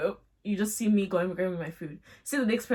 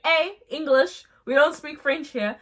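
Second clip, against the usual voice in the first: playful scolding; 5 semitones higher, 5 dB louder than usual. Retold or imitated. Narrator grabbing Tim to stop the French, louder playful scold. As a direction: cut him off with a teasing command.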